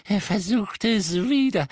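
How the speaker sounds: usual speaking voice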